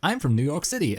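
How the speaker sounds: high-pitched mock big-city lawyer; liberal feminist lawyer. Co-host quotes the opposing Simpsons lawyer, high mock voice. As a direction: announce it high, as a cartoon attorney. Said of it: "this, uh, liberal feminist lawyer".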